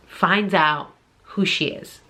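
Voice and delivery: normal talking voice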